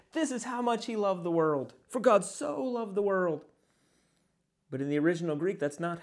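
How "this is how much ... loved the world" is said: higher, sweeping recital with arms spread wide; mock preacher, arms spread. Preacher mocking how believers recite with arms out. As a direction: ham up the familiar reading, stretching this much. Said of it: "and we say".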